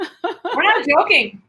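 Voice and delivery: slow words broken by laughter; laughing as she insists they're not joking. Real, not acted. Co-host laughing while denying they're joking. Laughing through mock denial.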